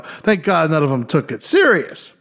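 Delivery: ordinary delivery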